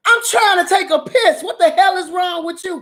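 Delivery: high yell from the bathroom; retold yell back. Yelling at the attorney from the bathroom, high retold shout. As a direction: shout back like you're down the hallway. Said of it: "I yelled out from the bathroom".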